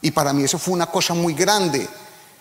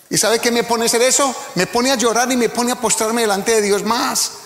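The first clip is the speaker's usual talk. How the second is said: preaching at full force; 6 semitones higher than usual. Acted. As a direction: preach hard to the congregation. (predicación a todo pulmón, aguda y fuerte)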